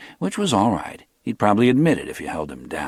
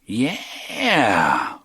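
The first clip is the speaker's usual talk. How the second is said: dragged out; 3 semitones higher than usual. A narrator's reading. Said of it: "dragging the word".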